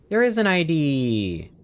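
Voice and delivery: high, loud and slow announcing the find; big excited reveal; excited discovery. Real, not acted. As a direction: call it out, thrilled. Spots an ID on table.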